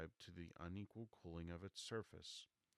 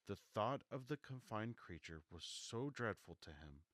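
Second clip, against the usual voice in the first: ordinary delivery, nothing marked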